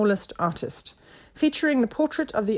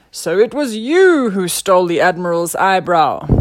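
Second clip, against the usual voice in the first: loud detective accusation; grand unmasking of the thief; accusing inspector; 9 dB louder than usual. A narrator's reading. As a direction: confront the culprit, loudly.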